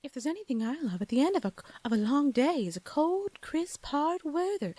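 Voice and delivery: lilting mock British ad pitch; affected British-Southern lilt, stretched at the end; seventies British-Southern accent. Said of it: "Southern British accent".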